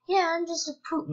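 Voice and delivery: high-pitched, carefree little creature voice; happy-go-lucky poop. Acted. Player voices the harmless-looking boss, happy and high. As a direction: pipe up high, all happy and easy. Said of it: "he looks all happy and easy".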